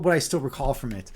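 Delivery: normal talking voice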